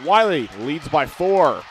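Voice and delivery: punchy live basketball commentary; energetic play-by-play. Real, not acted. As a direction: announce the tense restart with drive.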